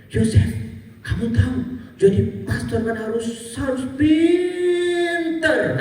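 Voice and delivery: high, singsong teacher stretching out pinter; mock the teacher, draw the last word into a song; drawn-out mocking teacher. Religion teacher warns Yosef about priest school, high drawn-out singsong. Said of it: "Pinternya panjang banget".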